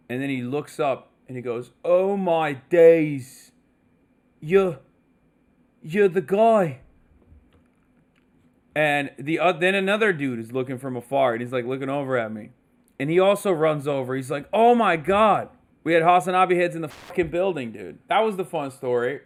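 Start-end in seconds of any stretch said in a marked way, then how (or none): 1.8-6.8 imitated British fan, stunned and louder; drawn-out amazed recognition, acted out; gobsmacked shop worker impression; starstruck exclamation. 14.5-15.5 imitated fan's loud, high exclamation; acted-out amazed greeting; excited recognition. Acted second fan running over excitedly.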